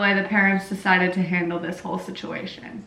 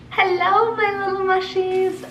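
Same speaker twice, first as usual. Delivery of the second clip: bright high greeting; 10 semitones higher, 3 dB louder than usual. Real, not acted. Cheery greeting.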